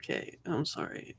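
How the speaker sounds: whispered, soft sorry; whispered apology. Real, not acted. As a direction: murmur it, barely voiced. Player apologizing for lag.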